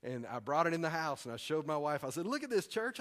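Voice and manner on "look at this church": excited. Retold or imitated. Showing wife the church flyer.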